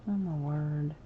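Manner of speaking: ordinary talk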